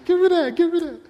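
high, excited woman's voice; hammed-up high-pitched excited woman; eager woman. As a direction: squeal it out, grabbing for the water. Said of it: "She like".

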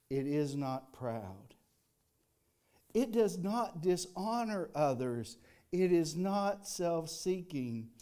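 each line slow and weighty; slow reverent reading. Shown as a demonstration. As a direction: let every phrase sink in. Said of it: "let these words of wisdom wash over you".

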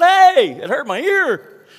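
loud high Hey! then slow, sharp scolding; annoyed shout. Retold or imitated. Pastor retells scolding the kid who screamed, loud annoyed yell. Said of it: "I just said".

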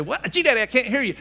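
high, loud mock child voice; complaining grandkid. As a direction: imitate a kid who can't hear Granddad. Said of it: "they'd say".

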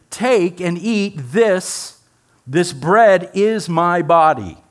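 slow, deliberate, each word set down; very clear, slow. Retold or imitated. Jesus to his friends at supper, slow and clear. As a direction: spell it out slowly and clearly. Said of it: "he says very clearly".

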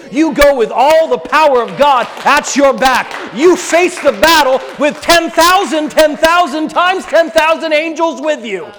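preaching loud and high, at full force. Acted. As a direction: thunder it out like a revival preacher.

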